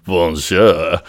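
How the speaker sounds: put-on French accent, drawn-out greeting. Patrice greets Polly in the kitchen, French accent. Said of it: "you're, you're French".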